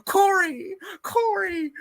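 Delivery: high whiny calling of Corey; mock whining girl. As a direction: whine his name like the lovesick heroine.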